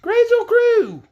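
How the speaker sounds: high, loud, slow call. Acted. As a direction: shout the magic words high. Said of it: "Scraps called out".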